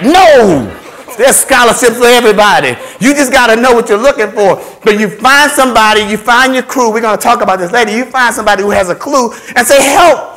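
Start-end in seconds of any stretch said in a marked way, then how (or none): 0.0-0.8 loud, high, drawn-out "No!"; exasperated cry of denial; emphatic refusal. Real, not acted. Angrily rejects the myth. 9.8-10.3 high, loud plea; acted shout for assistance; calling for help. Acts out asking somebody for help.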